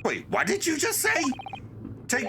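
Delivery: very high, incredulous question; high-pitched alarmed double take; shocked. Acted. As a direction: react in acted disbelief. Figure shocked by news of a death.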